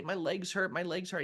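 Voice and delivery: high, plaintive mom voice; mock whine about aches; imitating mom's complaint. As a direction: complain like a sore patient, pitched high. Voicing mother complaining in therapy.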